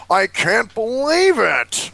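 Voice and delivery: gasping, slow, high fake disbelief; hammed-up astonishment; mock gasp. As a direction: overplay the shock. Mock shock at dentist line.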